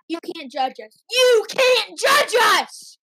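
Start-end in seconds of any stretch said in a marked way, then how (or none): 1.1-3.0 high, slow, forceful yell; loud protest. Pretend. Kid tells Mom and Dad off on air, loud protest. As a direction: shout it slowly, each word stretched.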